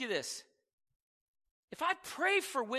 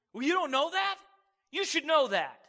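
higher, louder scolding taunt; mock taunting God; 6 semitones higher, 6 dB louder than usual. Preacher mimics a taunting God, higher louder scold. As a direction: sneer like a disappointed critic. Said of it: "God doesn't look down and go".

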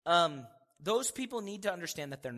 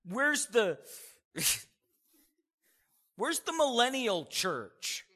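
mock searching; 7 semitones higher, 6 dB louder, slower than usual. Mock asking for the millennial church.

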